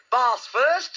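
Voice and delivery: high, bright sing-out; cheerful. A narrator's reading. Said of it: "in a cheerful voice".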